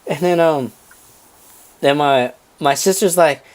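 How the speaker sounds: ordinary talk, nothing marked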